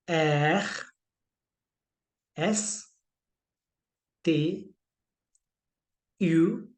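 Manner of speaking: each letter said slowly in French; French alphabet recital. Shown as a demonstration. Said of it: "दोबारा से pronunciation इसकी देखते हैं". (French वर्णमाला धीरे धीरे)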